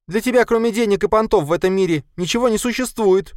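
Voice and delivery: very high, loud female cry; shrieked reproach in high voice; shouted accusation. A narrator's reading. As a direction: shout it at your brother. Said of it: "крикнула Катя".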